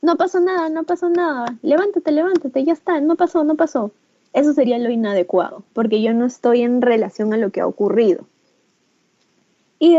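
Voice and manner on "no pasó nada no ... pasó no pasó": high, hurried brush-off; dismissive parent. Shown as a demonstration. Teacher showing the wrong answer to a fallen child, high and dismissive. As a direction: wave away the fall quickly. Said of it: "Inadecuado sería".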